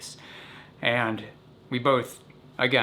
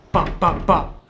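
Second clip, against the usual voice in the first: imitated knock; 6 semitones higher, 7 dB louder, slower than usual.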